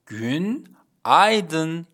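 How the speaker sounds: گونایدن stretched out very slowly; slow Turkish demo. Shown as a demonstration. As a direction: model گونایدن slowly, syllable by syllable.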